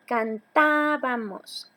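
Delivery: "cantábamos" slowly as can-TAAA-ba-mos; teacher's drawn-out stress on TÁ in "cantábamos"; stressed third-last syllable. Shown as a demonstration. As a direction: stretch the TÁ in "cantábamos". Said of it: "las esdrújulas siempre van a llevar acento".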